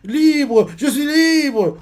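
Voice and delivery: high, loud shout of freedom; triumphant yell of release; exultant cry. Acted. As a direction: burst out, finally free. Villain escapes and cries freedom.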